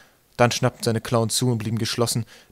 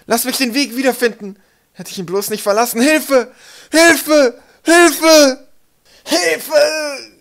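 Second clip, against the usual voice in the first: high pleading cry building to piercing screams; desperate screaming; 10 dB louder, slower than usual. A narrator's reading. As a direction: plead loudly, then scream for help. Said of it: "Der Schrei schnitt durch".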